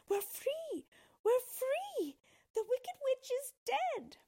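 put-on high, elated girlish shout; very high, triumphant child's cheer; jubilant cry. A narrator's reading. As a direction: cry out the good news in joy. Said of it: "she cried".

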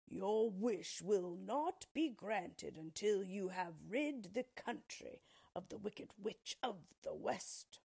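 slow, solemn wizard's decree. Acted. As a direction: pronounce it like a stern ruler. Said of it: "he gave the same answer".

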